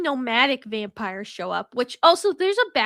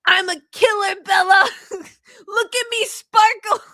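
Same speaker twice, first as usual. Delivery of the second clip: higher and louder, hammed-up anguished cry; mock dramatic shout; 8 semitones higher, 7 dB louder, slower than usual. Edward confessing to Bella, hammed-up shout. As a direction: overact the tortured vampire, slowing on the sparkle.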